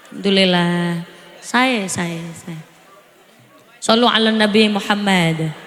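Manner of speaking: low, measured preaching voice; sermon delivery. Acted. Preacher blesses the children present, low and measured. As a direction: preach it calmly and deliberately.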